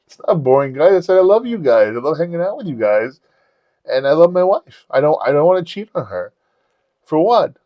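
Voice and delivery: high, pleading self-defence; protesting. Retold or imitated. Man defending his married life to friends, protesting. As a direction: insist on it, retold protest.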